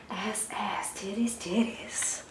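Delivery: hushed naughty whisper; giggly mock-scandalous whisper; cheeky whisper. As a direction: whisper it like a dirty secret. Joking about the sexy card.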